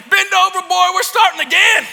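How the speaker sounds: high, loud commanding shout; hammed-up parental war cry; roaring father. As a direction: bellow it like a vengeful dad. Acts out father's triumphant return.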